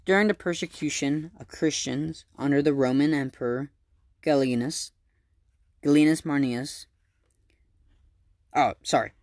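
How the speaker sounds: usual speaking voice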